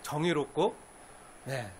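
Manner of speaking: ordinary talk, nothing marked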